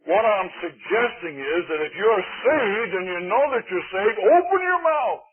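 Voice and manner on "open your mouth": rising high and loud, drawn out slowly; preaching cry. Real, not acted. Preacher crying out to the congregation, loud and slow. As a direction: call it out to the whole church.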